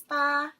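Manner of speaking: BA high, straight and level; first tone. Shown as a demonstration. Teacher comparing the tones for learners, BA high and level. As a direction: hold BA on one high pitch. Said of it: "if first tone is".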